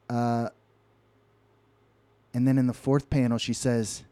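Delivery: usual speaking voice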